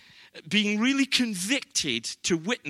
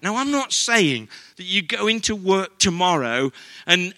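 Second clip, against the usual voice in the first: normal talking voice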